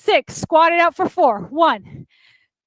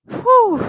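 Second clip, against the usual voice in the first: relieved exhale; 8 semitones higher, 3 dB louder than usual. Real, not acted. Exhale after mountain climbers.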